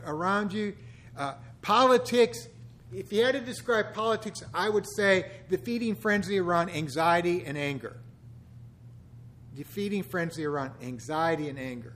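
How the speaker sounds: normal talking voice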